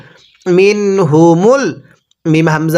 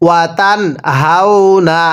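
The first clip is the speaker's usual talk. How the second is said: ordinary talk, nothing marked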